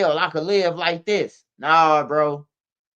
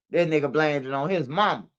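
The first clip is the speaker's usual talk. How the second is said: usual speaking voice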